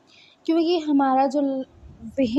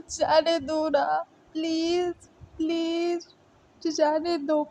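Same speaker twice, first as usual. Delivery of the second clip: higher, whiny pleading voice; plaintive begging; 4 semitones higher than usual. Acted. Wei Ying begs to go to his missing beloved, plaintive begging. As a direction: plead helplessly, drawing out each please. Acted tearful begging to be let go.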